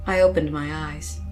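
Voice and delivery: ordinary delivery, nothing marked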